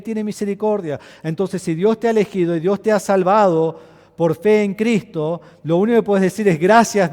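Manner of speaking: loud, rising sermon proclamation; preaching at full force. Acted. (predicación enérgica y fuerte)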